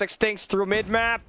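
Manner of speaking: ordinary delivery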